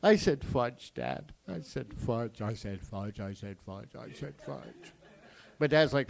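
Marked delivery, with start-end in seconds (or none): 0.4-4.9 low, fading nervous repetition; meek backtracking. Retold or imitated. Kid takes back a swear to dad, meek mock pleading. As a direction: shrink away as you repeat the excuse.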